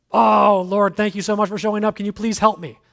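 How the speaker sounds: loud, high, breathless thanks; eager gratitude. Retold or imitated. Hanging man greets the Lord, loud eager pleading thanks. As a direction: pour out thanks and beg for help.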